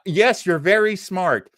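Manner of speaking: ordinary delivery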